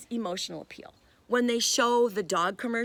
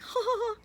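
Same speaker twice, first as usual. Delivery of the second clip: mock laughter. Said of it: "everybody was laughing like".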